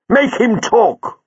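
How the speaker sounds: high, slow, hard demand; fierce command. A narrator's reading. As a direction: order it like a warrior. Leela demands.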